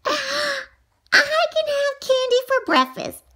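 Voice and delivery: gasp, then slow, high delight; excited. Acted. Child announcing her favorite rule, slow high excitement. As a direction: marvel at the idea.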